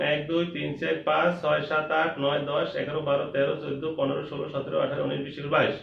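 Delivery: quick count in even pairs; brisk counting. Shown as a demonstration. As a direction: rattle the numbers off steadily. First quick count of mangoes.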